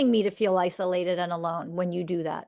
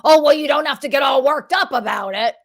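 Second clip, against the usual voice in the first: loud mocking whine of a dismissive partner; 4 semitones higher, 7 dB louder than usual. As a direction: shout it down like a partner brushing feelings off. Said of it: "partner dismissing feelings".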